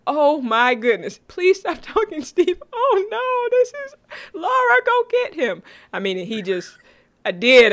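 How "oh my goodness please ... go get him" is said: much higher, louder overwhelmed squeal; gushing over Steve. Retold or imitated. Host retells begging Laura on TV, high excited squeal. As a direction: plead with the TV, half laughing. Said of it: "I'm like".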